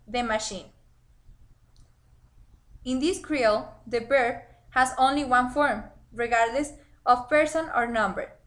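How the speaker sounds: ordinary talk, nothing marked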